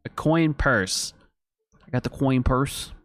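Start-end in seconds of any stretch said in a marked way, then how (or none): none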